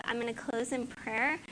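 ordinary talk

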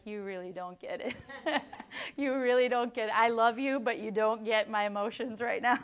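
a touch of exasperated wife, half laughing. Retold or imitated. Hannah to her husband, mildly exasperated and amused. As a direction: playfully tell him he just doesn't get it.

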